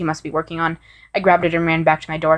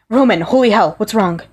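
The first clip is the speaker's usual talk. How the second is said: alarmed, raised voice calling out; panicked cry; 4 semitones higher, 4 dB louder than usual. Acted. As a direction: call his name in panic. Virgil panics over collapsing Roman.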